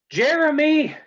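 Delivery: high, loud summoning call; calling out for a servant. Acted. Lady summons the gingerbread man, calling out high and loud. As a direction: sing out the name to fetch someone.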